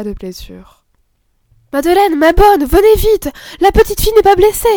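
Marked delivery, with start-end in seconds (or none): 1.7-4.7 higher and louder, calling out in joy; joyful cry. A narrator's reading. Camille calls her sister and nurse, joyful cry. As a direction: shout the good news with relief. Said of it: "un cri de joie".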